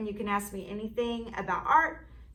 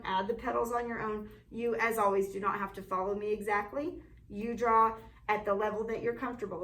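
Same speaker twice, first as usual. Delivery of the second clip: ordinary delivery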